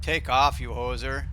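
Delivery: quoted catchphrase in mock Canadian accent, loud on the first words. Streamer trying out a soundbite, mock Canadian accent. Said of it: "would be a good one".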